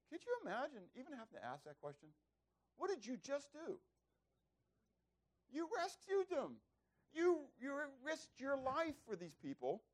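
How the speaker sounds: astonished, indignant high voice; incredulous. Acted. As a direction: marvel in a high strained pitch at the injustice. Preacher indignant on David's behalf.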